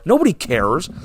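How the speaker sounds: ordinary delivery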